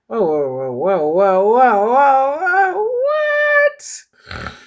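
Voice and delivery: whoas climbing to a loud, high squeal; rising, drawn-out amazed whoas; astonished outburst. Real, not acted. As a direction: build from disbelief to a shrieked what. Reader reacting to the story.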